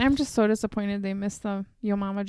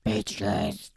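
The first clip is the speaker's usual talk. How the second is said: very low, gravelly Batman growl; 5 dB quieter than usual. Retold or imitated. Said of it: "he sounds kinda like Batman".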